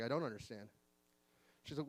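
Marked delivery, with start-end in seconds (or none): 0.0-0.7 low, soft admission of confusion; retold own reply. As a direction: answer her quietly, dropped in pitch. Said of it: "I was like".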